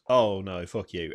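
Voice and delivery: the cruel game voiced with a hint of a sneer; mock game voice. Voicing the game mocking player.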